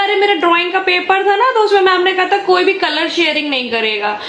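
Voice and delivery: put-on high childlike voice explaining; son's explanation. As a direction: play the son, eager and high. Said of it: "तो बोला".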